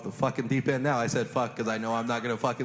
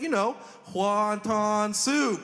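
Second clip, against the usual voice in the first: higher, slow, a hint of a clueless HR lady; matter-of-fact Heather; 8 semitones higher, 3 dB louder, much slower than usual. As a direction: spell it out as if obvious. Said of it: "She's like".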